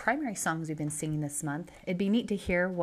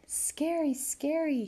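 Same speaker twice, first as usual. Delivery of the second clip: put-on high, drawn-out scared little girl; mild mock yell; 9 semitones higher, slower than usual. A narrator's reading. Child waking from a bad dream, mild high-pitched yell. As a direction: lightly voice a frightened child's cry. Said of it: "she yelled".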